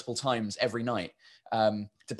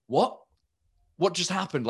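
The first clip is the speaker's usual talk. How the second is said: higher-pitched puzzled reaction; mock bafflement; 6 semitones higher than usual. Audience reacting to the dropped accent, baffled. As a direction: act out a confused crowd, voice lifted.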